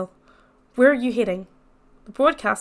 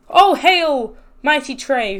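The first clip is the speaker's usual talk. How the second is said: loud, high hammed-up royal greeting; grand mock salute; mock hail; 4 semitones higher, 7 dB louder, much slower than usual. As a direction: hail him like a returning king. Mock hailing survivor.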